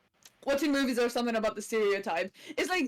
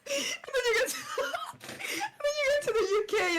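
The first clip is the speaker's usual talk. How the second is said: high, squealing laugh through the words; real helpless laughter; 14 semitones higher than usual. Real, not acted. Guest telling UK story, laughing uncontrollably. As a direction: struggle to speak while cracking up.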